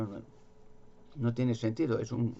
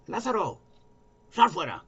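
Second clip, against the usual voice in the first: high, loud call into the dark cave; 9 semitones higher, 4 dB louder than usual. Retold or imitated. Jesus calling Lázaro out of the dark cave, loud high call. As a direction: call him out of the tomb with a raised voice. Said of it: "y gritó un poco".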